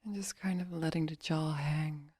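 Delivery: soft, unhurried meditation voice; guided meditation. Acted. Meditation coach relaxing listeners' face and jaw, soft guiding voice. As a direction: guide them gently into relaxation, leaving long pauses.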